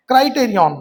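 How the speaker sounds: Criterion said loud with a falling tune; headword called out. Shown as a demonstration. Teacher loudly calls out criterion to the class. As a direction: punch criterion out as the new word. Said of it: "teacher announces headword".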